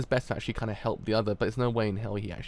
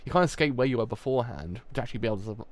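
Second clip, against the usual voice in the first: ordinary talk, nothing marked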